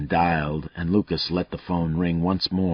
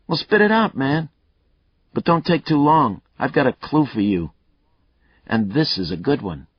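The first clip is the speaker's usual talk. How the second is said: a lightly voiced cocky killer, higher and louder; taunting killer; 10 semitones higher, 6 dB louder than usual. Killer Mayle taunting Lucas over the phone, cocky and loud. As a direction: goad the cop, enjoying himself.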